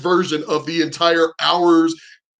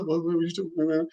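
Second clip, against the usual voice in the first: very soft, rushed muttering; confused mumble; 9 dB quieter, much faster than usual. Retold or imitated. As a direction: imitate a baffled reply under your breath. Retold confused reply to her.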